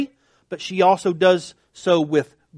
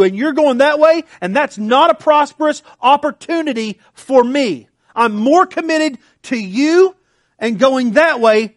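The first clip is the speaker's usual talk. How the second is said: higher, louder fervent vow; passionate vow; 6 semitones higher, 5 dB louder than usual. Retold or imitated. Ruth vowing loyalty to Naomi, raised and fervent. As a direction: pour it out with fervour. Preacher voicing Ruth's commitment.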